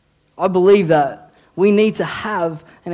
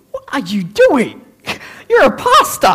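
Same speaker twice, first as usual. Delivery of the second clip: high, loud, incredulous squawk with a laugh; mock self-scolding; 8 semitones higher, 6 dB louder than usual. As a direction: scold yourself in comic outrage, pitch leaping up. Acting out scolding himself, laughing.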